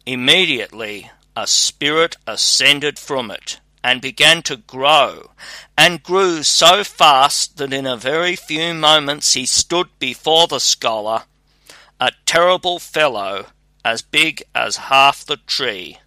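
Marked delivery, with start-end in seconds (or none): none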